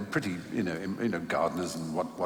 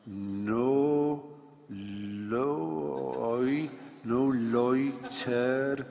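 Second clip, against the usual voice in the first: spelling out each word very slowly, like a new reader; slowly sounding out; 3 semitones higher, much slower than usual. Retold or imitated. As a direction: sound out the sign syllable by syllable. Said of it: "going things like".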